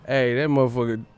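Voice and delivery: ordinary talk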